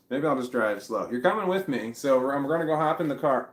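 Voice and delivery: normal talking voice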